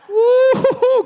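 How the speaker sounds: high-pitched drawn-out woo-hoo; gleeful whoop. Acted. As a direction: let out a long high whoop. Celebrating over his other kids.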